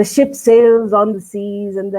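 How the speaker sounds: very slow, sing-song schoolchild poem recital; rote school recitation. Shown as a demonstration. A child at a school poetry recitation, droning very slowly. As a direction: recite it like a kid reeling off a memorised poem. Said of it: "ऐसे ना करके".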